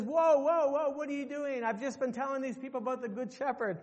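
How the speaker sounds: the guide yelling in shock, then calming; startled guide calling out, then explaining; alarmed outcry. Retold or imitated. Tour guide stops the butcher.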